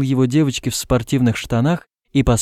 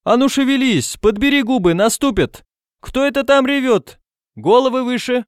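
very high-pitched schoolkid calls; high, bossy teenage hollering; bravely shouting youngsters; 13 semitones higher than usual. A narrator's reading. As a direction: chivvy them along in a high voice. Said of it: "мужественно покрикивая".

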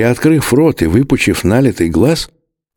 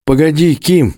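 raised, pleading cry; shouting, out of breath; 6 semitones higher than usual. A narrator's reading. Narrator pleads with Kim, a breathless shout. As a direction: call after him, breathless. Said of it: "закричал я, задыхаясь".

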